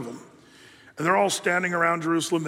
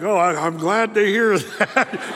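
relieved drawl; 5 dB louder, slower than usual. Retold or imitated. Peter, relieved, answers the strongmen in a slow drawl. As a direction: sigh it out in relief.

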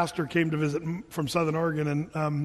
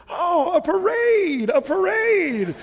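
high, loud, slow delighted cry; delighted pastor; 11 semitones higher, 6 dB louder, much slower than usual. Retold or imitated. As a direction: gush over the parade like an excited child, much higher and louder. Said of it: "and he says".

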